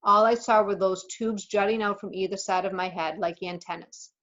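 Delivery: usual speaking voice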